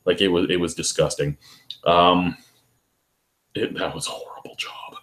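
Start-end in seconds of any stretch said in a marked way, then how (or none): 3.6-4.9 whispered aside; a hushed whispered aside. Real, not acted. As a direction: whisper it like a confession. Aside after gross story.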